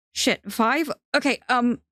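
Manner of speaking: high, flustered scramble for words; wavering voice. A narrator's reading. Theo given five seconds at knifepoint, wavering nervously. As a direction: panicky and unsure, pitch jumping up. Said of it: "His voice wavers".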